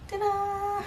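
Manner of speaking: high bright ta-da reveal. Real, not acted. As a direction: present it like a magic trick. Showing off finished skirt opening.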